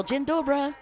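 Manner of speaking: high-pitched greeting in clumsy Polish; horrible Polish accent. Real, not acted. Announcer greeting fun people from Poland, horrible Polish accent. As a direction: say hello with a bad Polish accent. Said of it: "my horrible Polish accent".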